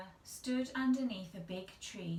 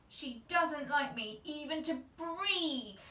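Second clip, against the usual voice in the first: slow, higher cross complaint; crossly; 7 semitones higher, 4 dB louder than usual. A narrator's reading. Girl complaining about her fussy aunt, cross. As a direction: sulk it out. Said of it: "Sally said crossly".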